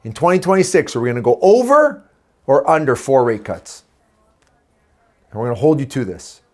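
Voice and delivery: ordinary talk, nothing marked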